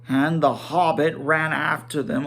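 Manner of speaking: ordinary delivery, nothing marked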